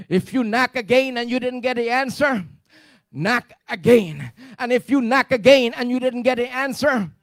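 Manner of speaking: loud insistent preaching; preaching at full force. Acted. Preacher pressing the church to keep praying, loud. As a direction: drive it home to the congregation.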